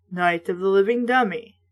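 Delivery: plain reading voice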